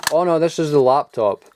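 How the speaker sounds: ordinary delivery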